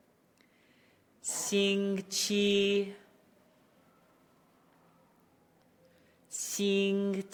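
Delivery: "星期" very slow, flat high tone; practicing tones. Shown as a demonstration. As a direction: draw out "星期" on one pitch. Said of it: "my tone and pitch is flat".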